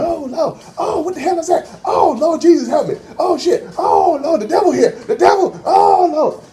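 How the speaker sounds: high-pitched, wailing cries to the Lord; mock screaming prisoner. Prisoner in the cell wails at the stench, high mock screaming. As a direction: ham up a frightened prisoner praying. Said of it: "this one guy started screaming".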